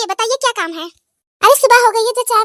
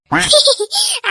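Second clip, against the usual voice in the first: laugh; 5 semitones higher than usual. Acted. Gossip between two women.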